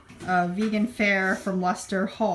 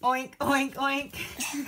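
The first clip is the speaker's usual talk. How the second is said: gentle pig oinks; 4 semitones higher, much slower than usual. Pretend. Pig noise for toddler.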